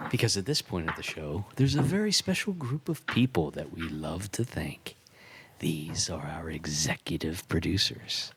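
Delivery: low, booming radio-announcer voice; deep announcer voice. Acted. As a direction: play the big show announcer. Deep announcer bit for sponsors.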